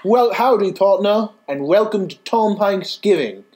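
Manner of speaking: host's terrible attempt at a British accent on his cowboy greeting; bad British accent. Host tries the British accent on his show greeting, terrible British accent. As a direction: lips pushed out, a mangled British accent on "howdy, partner". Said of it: "I'm also terrible".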